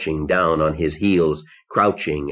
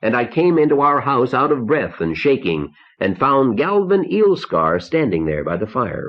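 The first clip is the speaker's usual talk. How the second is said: ordinary delivery, nothing marked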